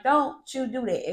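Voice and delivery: stern mom warning; stern heightened tone. Shown as a demonstration. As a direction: lay down the law to a toddler. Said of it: "you have to heighten that tone".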